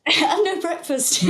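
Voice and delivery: high, loud, through laughter; real laugh breaking into the words; laughing agreement. Real, not acted. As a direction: echo the quip while laughing. Laughs along at the interviewer's quip.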